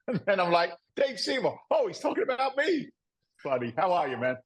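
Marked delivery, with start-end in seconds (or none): 1.0-2.9 high-pitched comic realisation; mock surprise. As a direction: act astonished to be the one introduced. Acted realisation he is meant.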